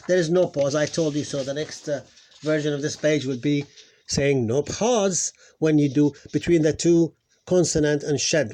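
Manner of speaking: ordinary talk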